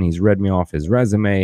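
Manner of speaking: ordinary talk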